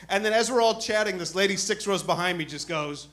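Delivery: ordinary delivery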